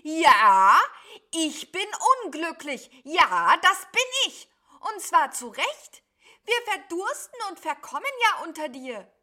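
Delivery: high, very loud outraged cry; shouting over thunder. A narrator's reading. Sheep yells its misery up at cloud beast, furious shouting. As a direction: yell it upward over a storm. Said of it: "schrie es hinauf über den Donner hinweg".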